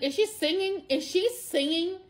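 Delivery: high, rising amazed questions; reliving hearing the child sing; disbelieving wonder. Retold or imitated. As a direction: ask it breathless with wonder. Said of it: "I'm like".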